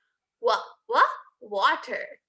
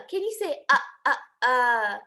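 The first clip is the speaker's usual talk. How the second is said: ordinary talk, nothing marked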